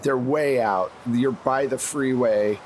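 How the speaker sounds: normal talking voice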